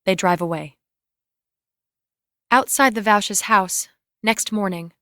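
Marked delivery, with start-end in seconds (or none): none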